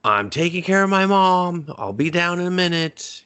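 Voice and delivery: lightly voiced young man calling down; imitating Jake. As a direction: answer from upstairs, offhand. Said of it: "And he's just like".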